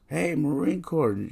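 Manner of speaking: high, loud, slow mock call; nurse calling out. Nurse butts in on Marine talk, loud high call. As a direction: imitate a nurse hailing someone, high and loud.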